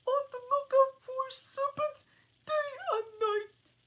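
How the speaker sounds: pigeon. Acted.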